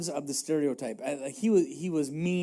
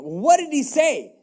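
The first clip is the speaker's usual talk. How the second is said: higher, louder, slower demand; bristling as you ask; an indignant David asking what Nabal said; 8 semitones higher, 6 dB louder, slower than usual. Acted. David reacts to Nabal's refusal.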